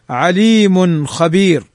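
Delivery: عليم خبير slowly, tanwin nun clear before the kha; slow clear reading. Shown as a demonstration. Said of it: "تنوين بعده الخاء". (قراءة بطيئة لـ عليم خبير مع إظهار التنوين)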